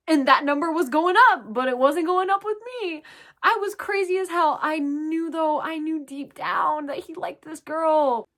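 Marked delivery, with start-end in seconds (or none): none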